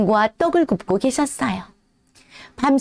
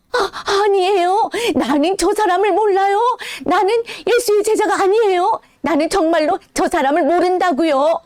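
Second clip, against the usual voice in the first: panicked denial; 7 semitones higher than usual. Acted. Peter denying Jesus to the servant girl, high and panicked. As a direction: deny it in a rush of fear.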